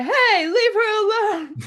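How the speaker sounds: high, crying plea to leave her alone; Ralph's protective cry. Retold or imitated. Friend imitates Ralph defending Vanellope, crying high. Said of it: "I love Ralph, where he's like".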